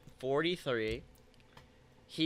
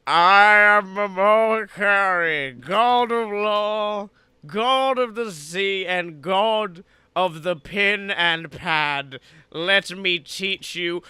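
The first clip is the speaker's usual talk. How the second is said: slow, loud, grand proclamation; booming sea god; 3 semitones higher, 9 dB louder, slower than usual. Retold or imitated. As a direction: announce yourself like a god of the sea. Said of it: "a deep voice echoing throughout the ocean".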